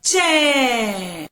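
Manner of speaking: "चै" drawn out long with a falling tone; ai-sound demonstration. Shown as a demonstration. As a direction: stretch the vowel in "चै" for learners. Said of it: "क्या बोलेंगे बेटा इसे हम".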